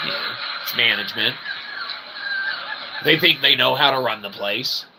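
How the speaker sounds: ordinary talk, nothing marked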